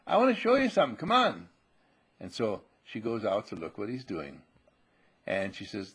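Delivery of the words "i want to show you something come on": put-on high, loud kid urging Mom; acted child's excitement; eager little boy. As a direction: tug Mom along with your voice. Voicing boy urging mother outside.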